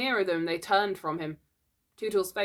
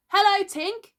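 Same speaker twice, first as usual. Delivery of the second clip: put-on high, loud boys' hail; wondering cry; 8 dB louder than usual. A narrator's reading. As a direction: call out in surprised greeting. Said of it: "cried the wondering boys".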